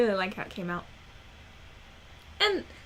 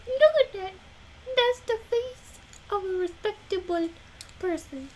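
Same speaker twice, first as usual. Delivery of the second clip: high mock-proud pet voice; 8 semitones higher than usual. Real, not acted. Praising Bo's respectable face in a high gushing voice. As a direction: gush over the puppy's noble face.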